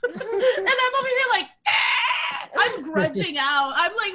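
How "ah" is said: gasping. Retold or imitated. Gasping, can't breathe.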